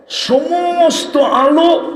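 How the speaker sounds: আলো stretched slow and high; drawn-out exaltation. Acted. Preacher lifts the crowd with সমস্ত আলো, drawn out.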